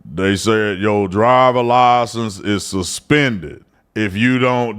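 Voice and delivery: put-on slow, low drawling old grandpa. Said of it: "an old grandpa voice where he's like".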